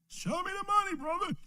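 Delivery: high, soft, hammed-up movie line; mock movie quote. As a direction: toss the quote off in a squeaky put-on voice.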